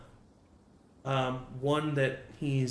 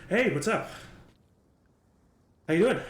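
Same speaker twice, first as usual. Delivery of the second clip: higher, louder upbeat hello; cheerful greeting; 6 semitones higher, 6 dB louder, faster than usual. Acted. Derek jogs up to greet Liam, upbeat greeting. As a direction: greet him bright and eager.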